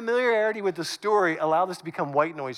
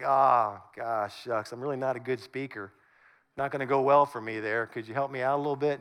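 reluctant; 8 semitones lower than usual. Acted. Moses begging off when God calls him, reluctant. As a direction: mumble shyly, backing out of the job. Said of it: "Moses was a little bit reluctant".

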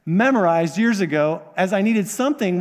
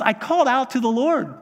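higher, urgent plea to God; pleading psalmist; 5 semitones higher than usual. Retold or imitated. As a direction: cry it out like a desperate man. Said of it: "words of a man crying out to God".

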